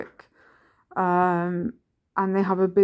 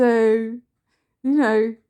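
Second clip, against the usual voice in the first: ordinary delivery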